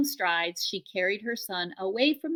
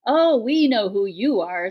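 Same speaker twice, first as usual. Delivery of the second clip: sarcastic; 2 semitones higher, 8 dB louder than usual. A narrator's reading. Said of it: "Her voice dripped with sarcasm".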